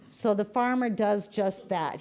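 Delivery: usual speaking voice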